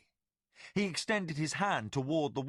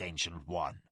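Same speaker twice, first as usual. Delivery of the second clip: low tiger warrior address; 9 semitones lower than usual. Acted. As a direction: spit the title with restraint.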